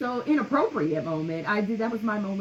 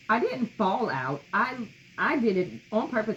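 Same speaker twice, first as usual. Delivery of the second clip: usual speaking voice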